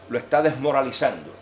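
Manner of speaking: normal talking voice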